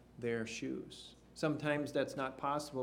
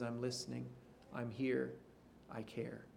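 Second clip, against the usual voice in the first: tender reassurance; 2 semitones lower, 10 dB quieter than usual. Retold or imitated. God answering grieving people.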